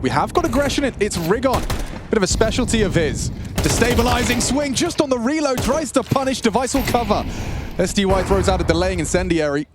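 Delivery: high, rising caster hype; excited play-by-play. Real, not acted. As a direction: call the action at full pitch. Hyped play-by-play.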